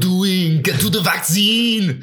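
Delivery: high, strained Austrian action-hero yell; Arnold Schwarzenegger. Acted. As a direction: holler it in a thick Austrian accent, drawn out. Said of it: "I still want you to do the voice".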